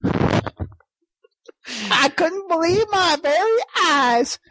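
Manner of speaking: put-on hillbilly accent. Mock-mournful hillbilly tale.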